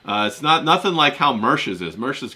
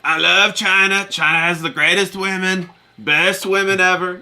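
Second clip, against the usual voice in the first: Trump impression; 4 semitones higher than usual. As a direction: ham it up as Trump bragging. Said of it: "roast me and my Trump".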